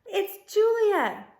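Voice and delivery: high, loud excited call. Acted. Girl calls to Ivan from behind the wall, loud and high. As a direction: shout it across the moat. Girl calling across the moat.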